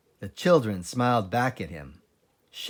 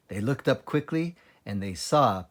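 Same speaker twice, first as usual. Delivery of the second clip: ordinary delivery, nothing marked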